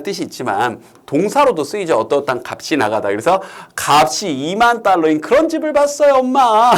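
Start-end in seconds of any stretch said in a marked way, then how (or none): 3.7-6.7 put-on high-pitched child showing off to mom; child telling mom. Child tells mom about an expensive house, hammed-up excitement. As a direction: pipe up excitedly, voice rising toward the end. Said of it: "이렇게".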